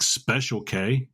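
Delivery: slow, deliberate Special K; stress on Special K. Shown as a demonstration. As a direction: spell out Special K so it sticks. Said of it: "the way people can remember that".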